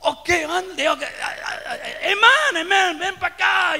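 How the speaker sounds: loud, high imitation of fired-up prayer; fervent Spanish prayer. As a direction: act out a congregation crying amen in Spanish. Acts out congregation praying in Spanish.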